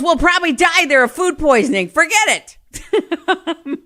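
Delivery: high, loud scornful dismissal; dismissive mother. Retold or imitated. Mother to father rejecting a Chinese restaurant, high, loud and scornful. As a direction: wave off the restaurant with disgust.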